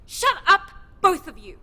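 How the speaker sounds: loud, sharp order to stop; stern shout. Acted. Silencing two squabbling companions, loud and stern. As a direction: cut the bickering dead.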